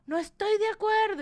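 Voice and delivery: high-pitched mock outrage; mock hysterical. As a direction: squeal it like a hysterical protest. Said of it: "histérica con WhatsApp". (indignada histérica, en broma)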